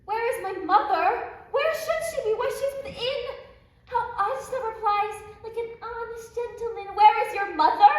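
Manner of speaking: high, loud half-laughing outburst; mock the odd reply in disbelief; laughing exasperation. Juliet exasperated at the nurse's answer, high and loud.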